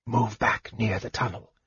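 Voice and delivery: hushed whispered instructions; soft, secretive mage whisper. Acted. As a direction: whisper orders to an ally. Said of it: "he whispered".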